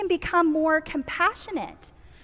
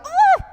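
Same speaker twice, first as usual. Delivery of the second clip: high, loud mock "ah"; acted yelp of pain; 8 dB louder than usual. Leader acting out a child stepping on a Lego, sharp pained yelp. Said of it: "Josie step on a Lego and go".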